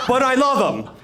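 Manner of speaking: hammed-up Italian warmth, punching love; the governor insisting he loves him, loud and laughing; Cuomo voice. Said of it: "He goes".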